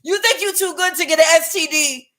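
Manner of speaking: high, loud mock West African accent; indignant, hammed-up Cameroonian accent; attempted Cameroonian accent. West African gaslighting an Empress, attempted Cameroonian accent. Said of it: "I can't do the accents tonight".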